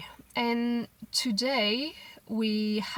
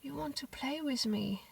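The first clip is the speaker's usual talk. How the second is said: quiet, higher, gently surprised; soft surprised question; 4 semitones higher, 7 dB quieter than usual. Retold or imitated. Mother to crying daughter, softly surprised. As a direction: ask it softly, just realising. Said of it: "I was like".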